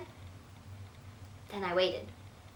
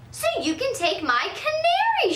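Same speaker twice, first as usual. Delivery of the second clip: higher, slow dawning delight; delighted grandma; 5 dB louder than usual. Acted. Grandma spotting the rule about birds, slow and delighted. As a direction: let the idea dawn slowly and happily.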